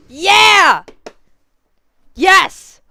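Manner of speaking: loud high yes; triumphant yell. Real, not acted. Streamer getting a good pull, loud high yells. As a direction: shout the win. Another win.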